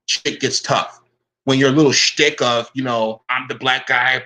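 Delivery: ordinary delivery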